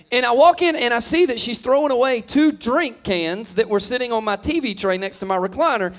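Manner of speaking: normal talking voice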